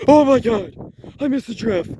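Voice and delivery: hammed-up high-pitched freak-out; panicked shriek. Earl panics over missed draft.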